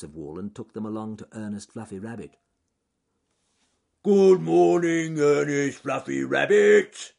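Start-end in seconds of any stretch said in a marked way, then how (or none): 4.0-7.1 slow, booming bear holler; shouted greeting. Acted. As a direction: holler down into a burrow, drawn out. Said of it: "he shouted down the entrance".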